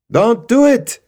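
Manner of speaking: high, very loud warning; urgent shout. Acted. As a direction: yell to stop him before it's too late. Lead guard warns Bernard.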